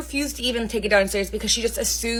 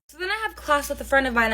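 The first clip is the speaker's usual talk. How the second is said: ordinary talk, nothing marked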